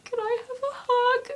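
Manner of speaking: very high, loud squeak; imitating your own overwhelmed fan squeal; retold starstruck plea. Fan asking an idol for a hug, squeaky and starstruck. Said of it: "And I was like".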